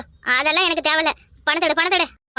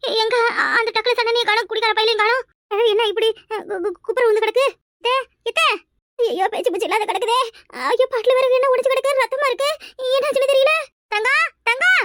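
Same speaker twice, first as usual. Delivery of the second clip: shocked panic; 4 semitones higher than usual. Acted. Finds mother-in-law collapsed.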